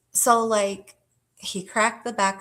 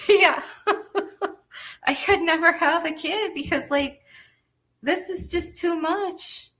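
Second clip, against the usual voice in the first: high, laughing exasperation; giggly high overwhelmed; 9 semitones higher than usual. Real, not acted. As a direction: laugh it off, pitched way up. Laughing at own story.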